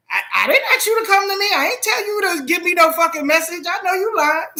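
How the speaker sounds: retold defiance, sped up and high; indignant retort. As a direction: rattle off the accusation. Retelling her own challenge again.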